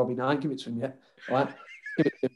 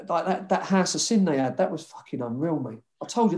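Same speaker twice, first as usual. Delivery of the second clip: ordinary talk, nothing marked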